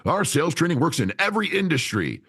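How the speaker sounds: high, hammed-up promotional claim; sales trainer boast. Sales trainers promoting their course, mocking hype voice. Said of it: "I hear a lot of sales trainers".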